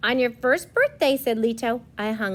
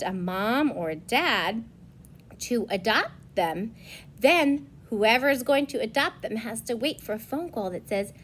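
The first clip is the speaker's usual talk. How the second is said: plain reading voice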